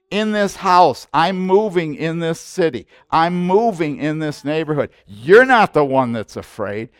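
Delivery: loud, driving sermon delivery; preaching at full force. Acted. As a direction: preach it hard to the congregation.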